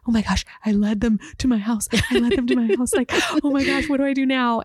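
a touch of panic, half laughing; mock-frantic, laughing at herself; retold panic. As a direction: relive the dread with a light laugh. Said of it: "and then I was like".

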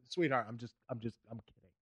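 very soft, almost murmured; barely heard apology. Retold or imitated. Comic to upset woman, near-silent apology. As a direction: mumble a sheepish sorry. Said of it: "So I was like".

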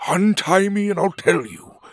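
high, loud, offended lizard voice; indignant old gizzard. A narrator's reading. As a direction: demand an answer, huffy and loud. Gizzard bargains.

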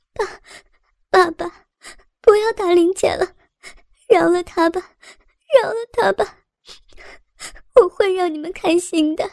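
high, tearful begging that breaks; a kneeling daughter begs her father, high and crying; crying plea. A narrator's reading. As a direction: plead with father through sobs. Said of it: "边哭边跪倒".

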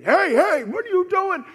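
high, full-volume accusing shout; enemy yelling. Acted. Enemies condemning you, loud high yelling. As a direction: yell it at them to condemn. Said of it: "they yell at you".

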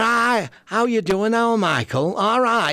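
hammed-up cheery Mancunian hello; Manchester greeting. Mum's side of the family greeting Michael, broad Manchester accent. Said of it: "And they're".

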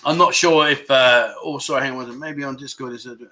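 ordinary talk, nothing marked

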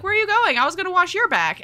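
mock outraged heroine; indignant surprise. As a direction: call after him, puzzled and put out. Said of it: "she's like".

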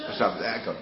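ordinary talk

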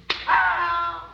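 high strained laugh after torture; a cracked gasp of a laugh; sudden high pained laugh; pained broken laugh. Acted.

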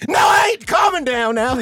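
high loud yell through laughter; laughing shout. Real, not acted. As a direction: shout it while cracking up. Laughing shout after told calm.

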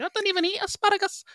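very high, fast mock Scouse; squeaky exaggerated Liverpudlian; Liverpool accent. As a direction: rattle it off in a cartoonish Liverpool accent. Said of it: "That kind of stuff is more like".

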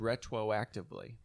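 "retroactively" said as "retwoactively"; cartoon W replacing the R in "retroactively"; Elmer Fudd W. Shown as a demonstration. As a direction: repeat the slip, W for the R in "retroactively". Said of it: "Like I'm Elmer Fudd".

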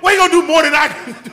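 loud, high-pitched challenge; heated boast. Real, not acted. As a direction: shout it over everyone arguing. Heated boasting over the others.

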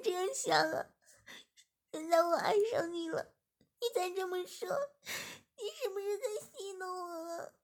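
high, loud, sobbing protest ending in a wail; crying while speaking. Acted. 志明 cries at 李文轩 for doubting her, high and sobbing. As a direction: weep out each word, hurt and pleading. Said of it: "志明边哭边说着".

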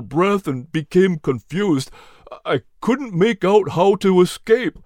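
lightly voiced dazed hound dog, shaken; a hint of a weary dog recounting danger; Nelson's voice. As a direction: recount the fire, still confused. Nelson tells his story.

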